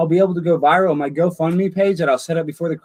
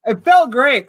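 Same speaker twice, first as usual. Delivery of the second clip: higher and louder, thrilled; excited; 10 semitones higher, 6 dB louder, slower than usual. Real, not acted. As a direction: burst out happily. Excited after the scene.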